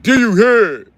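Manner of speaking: high, loud mocking voice; mock quote. Streamer reading LD's comment in a mocking high voice. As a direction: parrot the chatter like a cartoon. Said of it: "L D said".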